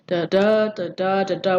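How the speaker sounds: "da, da" in light-heavy pairs; lulling singsong beat. Shown as a demonstration. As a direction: rock through the "da, da" syllables like a nursery rhyme. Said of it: "kind of lulls you like a nursery rhyme".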